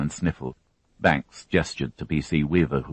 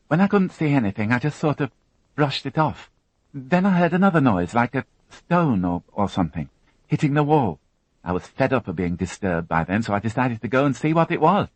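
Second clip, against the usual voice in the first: put-on higher, tense young woman recounting an ordeal; higher, strained witness voice; Alison, shaken young witness; 9 semitones higher than usual. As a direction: voice her as a frightened girl holding it together. Narrator voicing Alison's account.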